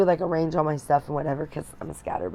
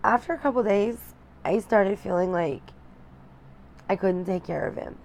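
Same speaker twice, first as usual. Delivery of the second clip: holding back tears; 3 semitones higher, 7 dB quieter than usual. Real, not acted. New mom confessing postpartum struggles to viewers, close to tears. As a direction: voice straining as tears come, long pauses.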